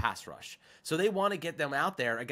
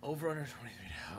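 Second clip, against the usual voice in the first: whispering; 3 semitones lower, 8 dB quieter, faster than usual. Real, not acted. Repeating the question to himself.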